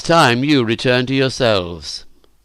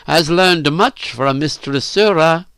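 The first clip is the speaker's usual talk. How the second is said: slow, raised, pointed remark; rather loud; 6 semitones higher, 3 dB louder than usual. A narrator's reading. Narrator needles Phyllis about her lessons, rather loud. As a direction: drive it home slowly and emphatically. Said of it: "I said rather loudly again".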